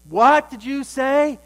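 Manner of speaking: outraged workhouse master roaring; big booming master. Retold or imitated. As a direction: loom over the boy and bellow. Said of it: "Mister Bumble is real big".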